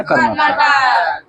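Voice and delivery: slow, stretched high call of the answer; drawn-out answer. Real, not acted. Student calling out the state answer, slow and stretched.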